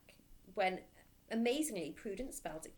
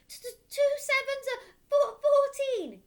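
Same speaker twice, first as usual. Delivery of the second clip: much higher and louder, stammering cry; terrified stammer; 14 dB louder than usual. Acted. Boy dangling by his hair, stammering and crying. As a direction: cry it out in terror. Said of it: "gasped Rupert".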